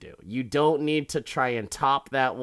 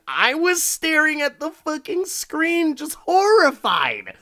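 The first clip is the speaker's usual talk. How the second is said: high-pitched, loud horrified outburst; worked-up, high cry of horror; 6 dB louder than usual. Retold or imitated. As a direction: wail it in appalled disbelief. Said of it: "staring at him horrified".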